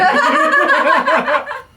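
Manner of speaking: loud high cackle; high burst of laughter. Real, not acted. Laughing at the friend's joke, loud high cackle. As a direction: explode into laughter.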